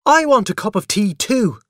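put-on high, loud childish demand; shouted. A narrator's reading. Boy demands tea from mother, shouting. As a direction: demand your share like an indignant boy. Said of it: "I shouted".

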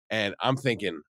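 ordinary talk

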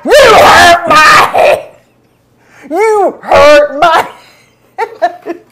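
very loud, high, slow wail; hammed-up pained yell; enraged outburst. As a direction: scream it at him, stretching each word. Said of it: "I went just like this in the car".

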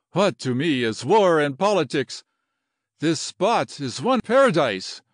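grand, emphatic declaration of love; impassioned Latin general. Acted. General gives up war for his new angel, impassioned. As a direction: proclaim it like a smitten officer.

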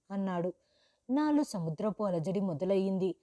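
ordinary delivery

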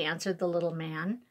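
ordinary delivery

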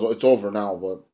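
ordinary talk

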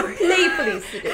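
high laughing call; laughing shout. Real, not acted.

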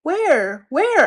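high, loud "Where? Where?"; eager question. Acted. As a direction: look around excitedly. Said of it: "asked Ox".

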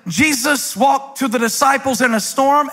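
ordinary delivery

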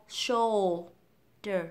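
"shoulder" very slow, split after a dark L; correct shoulder. Shown as a demonstration. Teacher modelling tongue lift, "shoulder" slowly with L. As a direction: stretch "shoulder" syllable by syllable, sounding the L. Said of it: "sẽ kéo cái lưỡi của mình lên".

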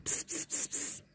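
soft pss pss to lure a cat; quick hissed calls; cat-calling hiss. Real, not acted. Luring the shy cats closer with soft hisses.

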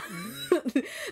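short high laugh after a cheer; gleeful laugh. Real, not acted. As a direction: laugh with glee at the win.

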